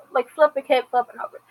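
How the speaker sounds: ordinary talk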